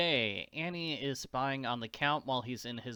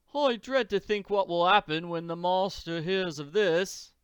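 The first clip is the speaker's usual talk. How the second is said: higher, louder dreading servant voice; worried servant; 5 semitones higher, 5 dB louder than usual. Acted. Second servant dreading the master's return, worried. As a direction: fret like a servant fearing the master.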